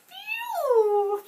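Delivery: high, loud cry of disgust; disgusted shout. A narrator's reading. Passengers holding noses at Dirty Bert, shouting. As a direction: shout out a long phew at the stink. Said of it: "would hold their noses and shout".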